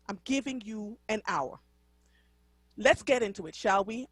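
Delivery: ordinary talk, nothing marked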